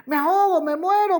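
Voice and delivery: a drowning man calling out to his master in panic; panicked cry for help. A narrator's reading. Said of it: "Empieza a gritar".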